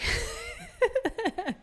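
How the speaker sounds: high, breaking giggle; helpless laughter. Real, not acted. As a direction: crack up after saying sorry. Apologising after her accent attempt.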